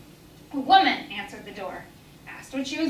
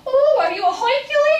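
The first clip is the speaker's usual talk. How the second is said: much higher, louder mocking taunt; squeaky teasing voice; taunting kugel; 13 semitones higher, 6 dB louder than usual. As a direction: kugel mocking her pursuer. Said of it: "taunting her".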